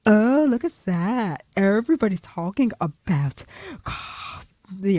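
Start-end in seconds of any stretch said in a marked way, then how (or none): none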